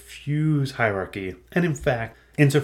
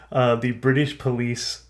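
ordinary delivery

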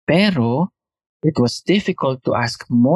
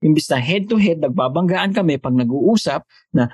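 normal talking voice

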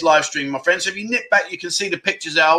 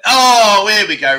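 higher, louder burst of delight; sudden excited oh as mum's comment appears; 3 semitones higher, 6 dB louder, slower than usual. Real, not acted. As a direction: pounce on it, pleased. Spots mum's comment arriving.

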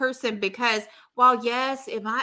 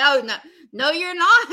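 louder, rising mock refusal; acted firm refusal; 5 semitones higher, 6 dB louder than usual. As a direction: shut him down, playing it up. Acting out Betty refusing Kevin's excuse.

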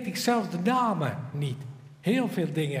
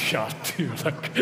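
laughing disbelief; 3 semitones lower than usual. Retold or imitated. Miranda laughs off his claim.

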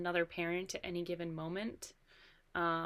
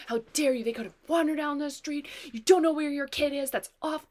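imitating a high, loud furious yell; mock screaming at a careless mother; angry berating; 9 semitones higher, 9 dB louder than usual. Announcer berating the lost kid's mother, loud angry yelling. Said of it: "They were just berating this parent".

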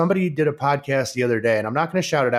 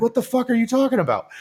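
higher, pitched-up mom voice, baffled and upset; retold mom at her wit's end, voice raised high; mock upset mom; 10 semitones higher, faster than usual. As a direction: play the mom losing it, half-joking. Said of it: "she was like".

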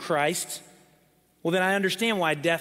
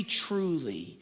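hushed repeat; 6 dB quieter than usual. Retold or imitated. Pastor quoting Jesus, repeating softly for weight. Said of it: "when he wants to get our attention".

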